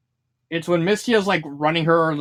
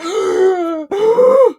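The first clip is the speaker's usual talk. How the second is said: high, loud theatrical gasp; mock gasp; 13 semitones higher, 6 dB louder than usual. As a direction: gasp like a stage surprise. Moku comes back to life.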